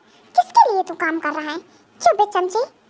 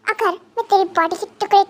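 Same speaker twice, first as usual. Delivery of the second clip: usual speaking voice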